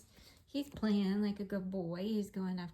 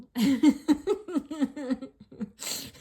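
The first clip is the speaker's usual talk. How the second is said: loud laugh; 6 semitones higher, 11 dB louder than usual. Real, not acted. Laugh after shedding remark.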